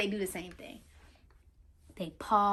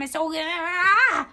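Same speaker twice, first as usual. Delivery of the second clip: stretched-out frustrated ah; overwhelmed cry; 5 semitones higher, 7 dB louder, much slower than usual. Shown as a demonstration. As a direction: burst out overwhelmed, loud and slow. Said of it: "That's how I feel".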